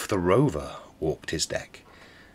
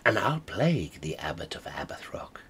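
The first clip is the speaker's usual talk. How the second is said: whispered with wicked glee; a pirate's sly whisper of mischief; scheming whisper; 3 semitones lower, 5 dB quieter than usual. Acted. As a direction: hush it like a secret plot. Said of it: "Quoth he".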